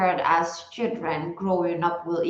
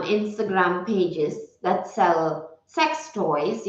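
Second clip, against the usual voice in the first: normal talking voice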